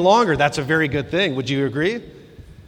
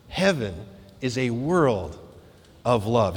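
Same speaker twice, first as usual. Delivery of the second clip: soft reverent repeat; 8 semitones lower, 8 dB quieter than usual. Shown as a demonstration.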